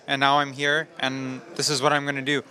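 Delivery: ordinary talk, nothing marked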